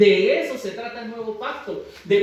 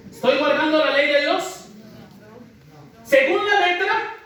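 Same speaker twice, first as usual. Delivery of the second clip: high, raised preacher's challenge; pitched-up rhetorical question; emphatic questioning; 8 semitones higher, 4 dB louder than usual. Real, not acted. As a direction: put the question loud and high to the congregation. Preacher calls out question to congregation.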